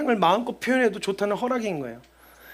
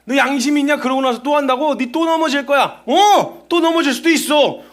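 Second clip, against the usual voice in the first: a hint of a sneering accuser, louder and higher; mocking Satan; 5 semitones higher, 4 dB louder than usual. Satan jeering at a believer who fell, with a lightly sneering voice. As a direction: taunt like the devil. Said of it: "사단이 우리를 자꾸 비웃는 거예요".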